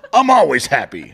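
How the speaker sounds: laughing, loud, over-the-top insistence. Shown as a demonstration. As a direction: shout it while cracking up. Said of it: "Look at me and ask me".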